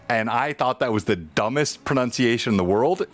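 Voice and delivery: normal talking voice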